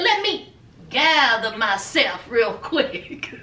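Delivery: low, slow mock self-control; composing himself. Man before the couch talk, composing himself. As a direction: imitate someone reining themselves in.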